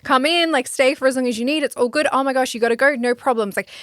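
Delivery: high, loud, thrilled welcome; overjoyed hello to an old friend; excited greeting. Pretend. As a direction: greet money like a long-lost friend. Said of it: "could I get really excited and be like".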